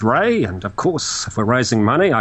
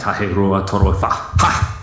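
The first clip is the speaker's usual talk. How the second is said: lower, clipped martial count with a kiai; ninja counting; 6 semitones lower, slower than usual. Acted. Counting to ten on request, mock ninja. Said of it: "He sounds like a ninja".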